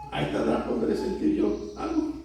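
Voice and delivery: hushed, voiceless aside; thinking aloud. Acted. Sara doubting the angel's promise to herself, hushed thinking aloud. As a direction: murmur it privately to yourself. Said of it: "Estaba pensando en voz alta".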